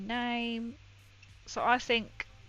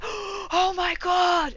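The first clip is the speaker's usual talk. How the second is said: mock freak-out; 9 semitones higher, 8 dB louder, much slower than usual. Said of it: "they'll be like".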